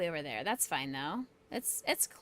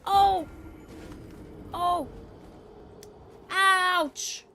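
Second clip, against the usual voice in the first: high loud mock ouch; hammed-up pain cries; 11 semitones higher, 8 dB louder, much slower than usual. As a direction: overplay getting hurt. Taking hits in game.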